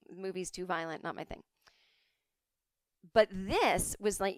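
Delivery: ordinary delivery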